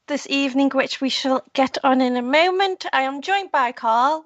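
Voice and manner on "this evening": usual speaking voice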